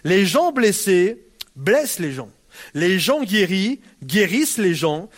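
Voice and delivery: slow, weighted saying; slow maxim. Shown as a demonstration. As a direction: spell the proverb out slowly. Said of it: "mon professeur Denis Morissette qui nous disait ça".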